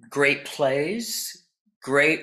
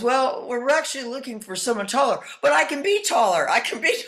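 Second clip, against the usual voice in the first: eager actor voice; 11 semitones higher than usual. Retold or imitated. Acting out Tootsie audition.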